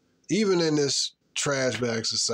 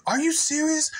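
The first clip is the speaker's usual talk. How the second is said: mock outraged woman; 14 semitones higher than usual.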